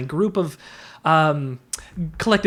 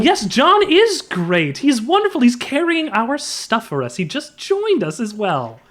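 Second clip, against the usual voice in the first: sarcastic praise; 6 semitones higher than usual. Acted. Character gushes about John.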